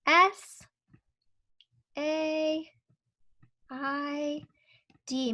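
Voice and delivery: letters spaced out one by one; spelling out letters. Shown as a demonstration. Teacher spelling a sight word while tracing, slow. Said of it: "how do you spell said".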